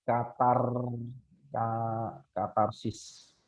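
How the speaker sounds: "katarsis" broken up, then drawn out syllable by syllable; spelled out slowly. Shown as a demonstration. Teacher spelling out a term for students, very slow.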